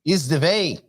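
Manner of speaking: mock German accent, v for w. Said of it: "the whole show in a German accent?".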